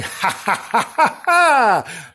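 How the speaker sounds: high, hammed-up evil laugh; mock-demon staccato cackle; demonic cackle. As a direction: cackle wickedly as you give chase. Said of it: "He's been going".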